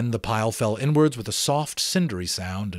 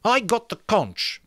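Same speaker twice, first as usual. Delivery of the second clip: indignant; 12 semitones higher, 3 dB louder than usual. Acted. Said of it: "Piggy said indignantly".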